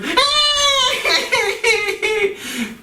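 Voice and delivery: high, drawn-out mock child's wail; hammed-up toddler cry, very high; imitated crying wail. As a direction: wail like a little girl whose tower fell. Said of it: "porque grita con un grito".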